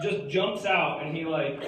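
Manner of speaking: ordinary talk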